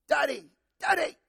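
put-on high little-kid voice calling for dad; toddler calling. Pastor imitating his toddler calling dad, high childlike call. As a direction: chirp it like a toddler wanting up. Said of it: "comes across the room and goes".

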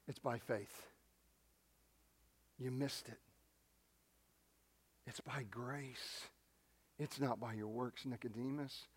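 dropped to a near whisper; quiet, whispered emphasis; hushed. Acted. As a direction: bring it down, soft and intimate. Sudden hush after rebuke.

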